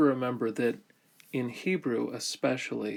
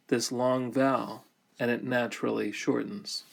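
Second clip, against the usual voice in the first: normal talking voice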